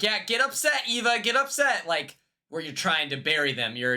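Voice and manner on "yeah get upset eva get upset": higher, mocking taunt of a heckler; mock egging on. Host mimicking a heckler egging Eva on, mocking taunt. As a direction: sarcastically egg her on, pitched up. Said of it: "and then you're like".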